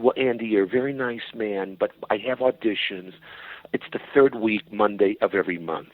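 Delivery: ordinary delivery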